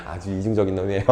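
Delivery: ordinary talk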